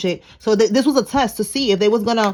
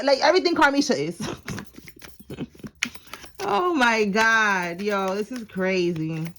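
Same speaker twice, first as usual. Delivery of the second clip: usual speaking voice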